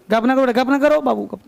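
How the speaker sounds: normal talking voice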